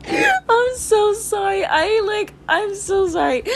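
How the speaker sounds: high, slow, giggling sorry; laughing apology. Real, not acted. Apologising to listeners, high and laughing. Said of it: "I feel so bad for say".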